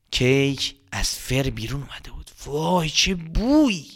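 usual speaking voice

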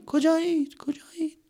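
high fading echo; fading cave echo. Acted. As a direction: let it ring away.

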